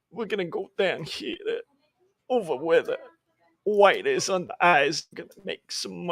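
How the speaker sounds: a goofy guy talking to himself as he colors; goofy stream voice. Pretend. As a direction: narrate coloring like a dopey guy. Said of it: "do a whole stream like that".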